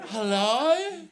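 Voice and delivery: put-on little girl. Little girl answers phone.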